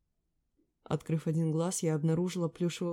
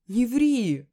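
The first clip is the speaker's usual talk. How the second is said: protesting cry; 6 semitones higher, 9 dB louder than usual. A narrator's reading. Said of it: "стану в ответ".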